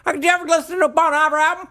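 high, hammed-up Southern yokel voice; Deep South accent. Friend mimics Southerners talking about Bon Iver, high Deep South accent. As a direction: play a giddy good old boy from the South. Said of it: "someone from the deep south".

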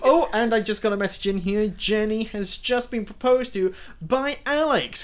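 high, loud mock on-air announcement; hammed-up DJ reading a listener message; airport radio DJ. Pretend DJ announcing a listener's engagement, big excited radio voice. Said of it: "a radio D J for an airport".

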